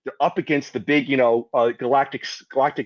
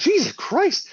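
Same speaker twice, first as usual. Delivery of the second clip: mock shock; slower than usual. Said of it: "I was like".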